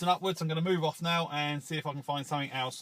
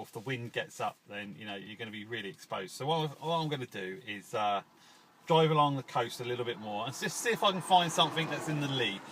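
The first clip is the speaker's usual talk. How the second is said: usual speaking voice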